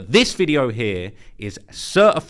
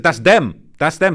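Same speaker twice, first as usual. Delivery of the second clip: louder, higher quoted shout; raised voice, relaying a shout; retold shout; 5 semitones higher, 9 dB louder, slower than usual. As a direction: imitate a bystander calling out. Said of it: "hears someone shout in the background".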